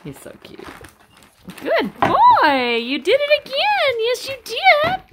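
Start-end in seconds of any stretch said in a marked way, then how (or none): 1.6-5.0 high, bright sing-song praise for the puppies; puppy praise talk. Real, not acted. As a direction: coo at the pups like a proud breeder.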